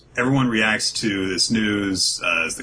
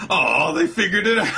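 higher, laughing aw of defeat; a giggly caught-out groan; laughing mock disappointment; 11 semitones higher than usual. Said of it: "he's like".

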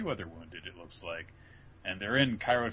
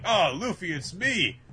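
excited call; 8 semitones higher, 6 dB louder than usual. Retold or imitated. Sabo greets Luffy.

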